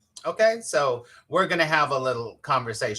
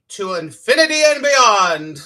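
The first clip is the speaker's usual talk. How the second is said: loud, high, drawn-out catchphrase; mock-heroic battle cry, stretched out; playful heroic proclamation; 6 semitones higher, 8 dB louder than usual. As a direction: proclaim it big and slow, for fun. Playful catchphrase, then laughs.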